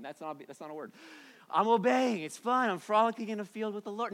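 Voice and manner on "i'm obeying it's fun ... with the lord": higher, louder, sing-song fake joy; sugary hammed-up delight; mock giddy cheer. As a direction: parody a believer gushing about obeying. Mocking a falsely cheerful believer.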